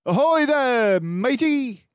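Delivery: loud, high hearty sailor greeting; disguised detective playing a jolly sea captain; old sea captain. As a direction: hail a shipmate across the deck. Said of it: "weather-beaten old sea captain".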